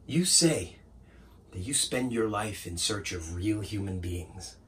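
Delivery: low, soft and restrained; quiet reproach. Acted. Assistant quoting the painter's search back, quietly. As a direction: confront him very softly. Monologue's hushed, wounded close.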